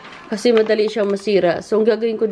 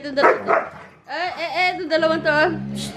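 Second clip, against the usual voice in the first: normal talking voice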